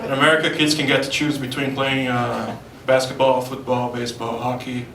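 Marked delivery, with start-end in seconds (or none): none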